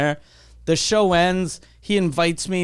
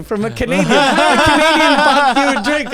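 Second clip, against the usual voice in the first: high, loud mock waitress; 8 semitones higher, 8 dB louder than usual. Waitress announcing a gift drink, hammed high and loud. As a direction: play the waitress, higher and louder.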